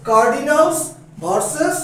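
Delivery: normal talking voice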